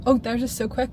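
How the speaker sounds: normal talking voice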